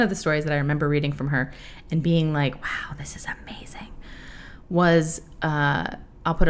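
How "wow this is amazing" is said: breathless whispered wonder; awed whisper. Retold or imitated. Recalling reading the fic, awed whisper. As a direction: hush it like a secret delight.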